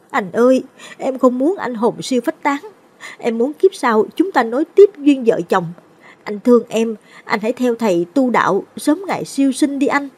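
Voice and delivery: high, pleading wife near tears; wife shielding her husband, crying and pleading; tearful begging. A narrator's reading. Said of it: "khóc lóc van xin".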